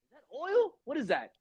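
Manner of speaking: lightly voiced shocked question, rising high; half-joking disbelief; a mild mock of gawking at Bob; mock surprise. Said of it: "I look up at him".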